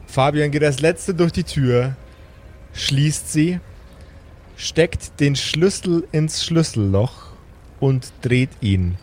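slow, measured scene setting; calm storyteller over music; game master narration. A narrator's reading. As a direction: set the scene for the players.

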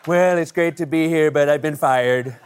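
slow, loud put-on president voice; doing Trump at a podium, dragging it out; mock Trump speech. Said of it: "given your inaugural address".

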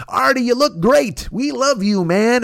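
high-pitched adoring crowd imitation; mock fan gushing. An army of people praising Artie, mock fan gushing. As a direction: gush high, like a blindly loyal fan.

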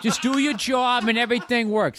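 high, hammed-up Boston guy; exaggerated Boston accent, pitched high. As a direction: play up the Boston vowels. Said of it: "you got it good".

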